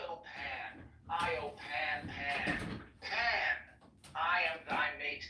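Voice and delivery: ritual hymn chanted out with surging emphasis on the refrain; incantatory poem recital. Acted. Reciting a hymn to Pan for friends, fervent incantation. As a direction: declaim it like an invocation, building on each cry to Pan.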